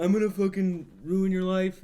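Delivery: high, loud mock menace; villain threat. Retelling a movie villain's threat, loud and high. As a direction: snarl the threat like a cartoon villain.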